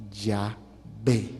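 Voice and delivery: Yahvé said low and slow, "ya-VÉ"; saying Yahvé. Shown as a demonstration. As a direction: pronounce Yahvé with stress on VÉ. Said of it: "¿cómo se dice?". (Yahvé pronunciado grave y lento, acento en VÉ)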